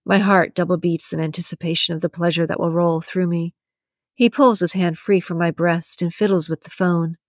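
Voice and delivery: plain reading voice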